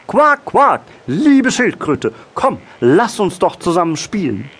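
very high, loud quacking frog; joyful frog call. A narrator's reading. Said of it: "Freudig rief der Frosch ihr zu". (freudig rufender Frosch, hohe Stimme)

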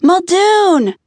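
high, loud call of his name; yelled name. A narrator's reading. Searching woman calling for a missing man, loud shout. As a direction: shout out for him. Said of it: "she yelled".

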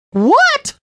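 very high, loud shocked scream. A narrator's reading. Lisa screaming at the officer in disbelief. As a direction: shriek it in disbelief. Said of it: "she screamed".

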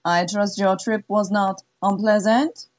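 an appraising madam savoring each word; French-Canadian accent. Acted. Zizi receiving Jude in her study, cool French accent.

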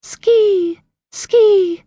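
high thin bird cry; trapped bird cry. Acted. A trapped bird crying for help, high squeak. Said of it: "the faint cry of a trapped bird".

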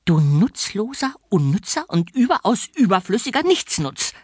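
put-on high, shrill shrieking old spinster; nasty orphanage warden screeching; shrieking matron. A narrator's reading. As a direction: screech the insults down at him. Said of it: "kreischte sie".

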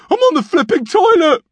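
high, loud fed-up shout; exasperated yell. A narrator's reading. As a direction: yell it through the door. Norm yelling at brother.

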